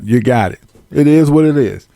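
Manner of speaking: usual speaking voice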